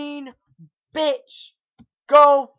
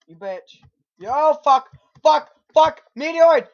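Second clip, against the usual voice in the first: usual speaking voice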